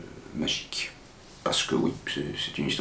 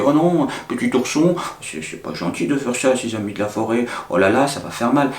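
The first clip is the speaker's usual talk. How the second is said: high, raised fairy voice; reproachful fairy; 6 semitones higher, 4 dB louder than usual. Acted. Fairy scolds Petit Ourson for hurting his forest friends, high mock reproach. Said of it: "il y a la fée qui revient et qui lui dit".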